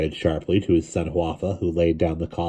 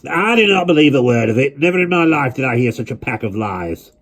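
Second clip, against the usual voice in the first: louder, higher flat refusal; declared loudly; 8 semitones higher, 5 dB louder than usual. A narrator's reading. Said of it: "he declared loudly".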